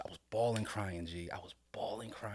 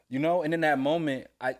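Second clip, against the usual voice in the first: usual speaking voice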